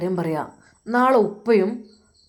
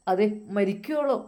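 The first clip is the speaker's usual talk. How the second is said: ordinary delivery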